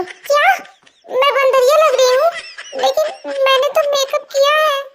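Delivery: sharp rising disbelief, pitch up; can't believe he called her a monkey; shocked. Acted. Assistant to boss, stunned and offended. Told she looks like a monkey.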